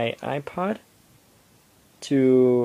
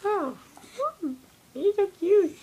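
dog voice. Real, not acted. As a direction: coo at the puppy up high. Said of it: "That's my dog voice".

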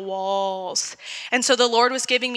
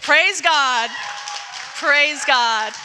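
exultant praise; 3 semitones higher, 3 dB louder, much slower than usual. Real, not acted. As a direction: lift it up to the church. Preacher exclaims praise.

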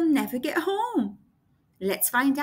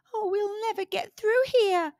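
dismayed exclaim; 8 semitones higher than usual. Acted. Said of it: "exclaimed Gasper".

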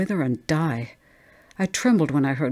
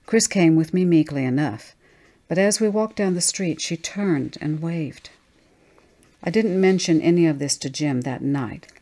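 ordinary delivery, nothing marked

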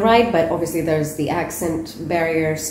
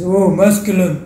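mock lower voice; 8 dB louder than usual. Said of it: "the lower voice is".

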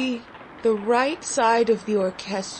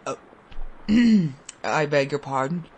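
embarrassed father; 7 semitones lower, faster than usual. Acted. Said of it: "he said".

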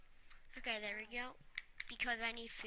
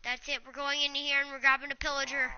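usual speaking voice

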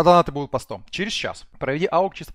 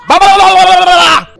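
excited ba-ba chant; 17 dB louder than usual. Real, not acted.

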